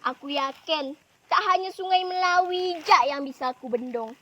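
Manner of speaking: laugh, then brag loudly, stretching the biggest words; triumphant storybook bragging; gloating boast. Acted. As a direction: show off to your rival with swelling emphasis. Character boasts to rival after laughing.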